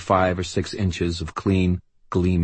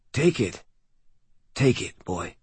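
urgent weak plea; 6 semitones higher than usual. Acted. Dying man urges Harry.

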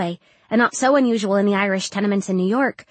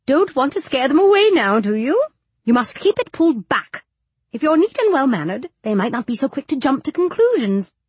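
higher-pitched, fussy matron voice; scolding bony matron; 4 semitones higher, 4 dB louder than usual. Acted. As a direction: lecture a girl on her appearance.

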